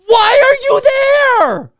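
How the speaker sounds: slow, high, loud yell of disbelief; a ranter losing it at phone users in theaters, yelling; exasperated shout. Real, not acted. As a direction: bellow the question at them, stretching it out.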